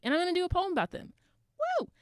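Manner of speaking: fast, high and loud hype; psyched to share a poem with the crowd; excited. Real, not acted. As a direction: rush it out, then cheer. Hyping up her next poem.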